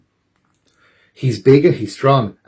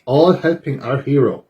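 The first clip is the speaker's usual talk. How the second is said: ordinary delivery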